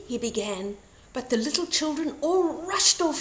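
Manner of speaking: usual speaking voice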